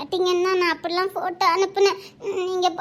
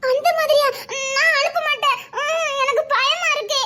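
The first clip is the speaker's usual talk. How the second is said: higher, teary fearful voice; scared and tearful; 5 semitones higher than usual. Acted. Frightened girl tells boyfriend she's scared, tearful. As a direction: admit fear through tears.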